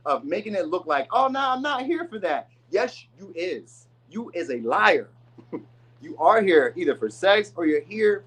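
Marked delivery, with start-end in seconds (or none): 1.1-2.5 mock innocent denial. Mocking a pretender's denial. 2.7-5.1 slow accusing retort. Retold or imitated. Scolding the pretender, slow.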